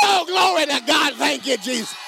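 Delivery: high shouted cry of glory; joyful shouting praise; ecstatic praise. Real, not acted. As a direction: erupt into praise. Preacher breaks into praise.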